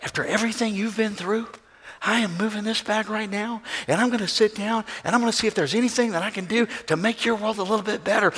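soft, tender reassurance; quietly offering help to the man on the plane; gentle kind voice. Acted. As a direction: speak softly as if comforting a hurting stranger. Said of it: "You'd be like".

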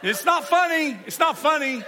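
laughing through it, high and loud. Real, not acted. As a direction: insist it isn't funny while cracking up.

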